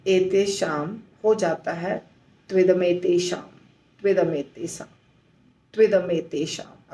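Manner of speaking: fuse तू इदम एतेषाम into त्विदमेतेषाम; joined sandhi form. Shown as a demonstration. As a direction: say त्विदमेतेषाम as one flowing word. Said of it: "आपको ऐसे बोलना होगा". (त्विदमेतेषाम एक साथ बोलें)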